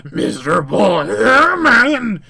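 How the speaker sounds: loud, slow monster voice; hissed villain's taunt, slow and loud; hissing demon. Acted. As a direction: draw it out like an enraged demon. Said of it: "it hisses".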